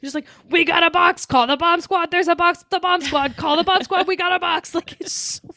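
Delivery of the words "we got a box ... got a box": mock urgent comms call over the radio; helicopter radio chatter. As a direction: imitate a pilot on the comms, rattling it off. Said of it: "the way they say it".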